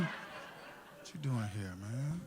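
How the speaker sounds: low, slow, very soft challenge; under-the-breath wary questioning; hushed suspicion. Acted. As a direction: drop right down and draw the question out. Catching the thieving cousin, repeated softly.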